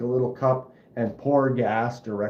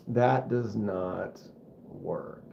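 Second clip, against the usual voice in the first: very slow, last word dropped almost silent; slow fading deadpan; 5 semitones lower, 6 dB quieter, much slower than usual. Shown as a demonstration. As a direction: drag it out and let "work" fade away to nothing. Second, dragged-out fading take.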